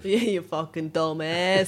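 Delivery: slow, loud mock New York Italian; jokey New York Italian put-down; put-on accent. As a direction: draw out the insult in a hammy Italian-American accent. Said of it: "who started that accent".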